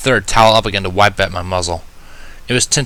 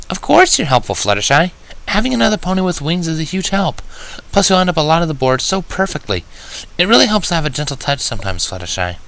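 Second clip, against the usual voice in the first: higher, gentle reassuring unicorn; kind Twilight voice; 8 semitones higher than usual. Acted. Twilight reassures the shy Fluttershy that she is helpful, kindly. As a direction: build her confidence warmly.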